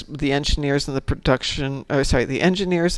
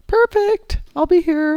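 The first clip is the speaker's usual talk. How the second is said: much higher squeal of joy; delighted squeal; 4 dB louder, slower than usual. Real, not acted. Delighted about the event.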